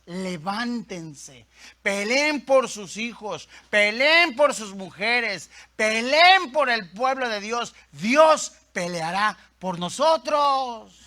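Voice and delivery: rallying battle exhortation, slow and forceful; building from measured call to loud, drawn-out cry; Nehemías. Acted. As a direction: rouse the people to fight. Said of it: "en esta voz de Nehemías".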